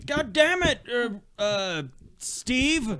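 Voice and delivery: high mock exasperated call; yelling across the room. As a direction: shout across the house at a family member. Suburban dad yelling.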